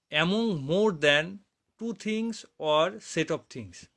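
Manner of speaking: very slow, each word separate; slow dictation. Shown as a demonstration. Teacher dictating the definition to students, very slow. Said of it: "teacher dictates definition".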